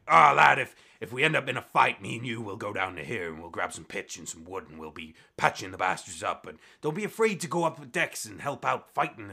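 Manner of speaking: a hint of a salty shipwright; gruff sailor. Shipwright Jagsy briefs the new hand, light sailor voice.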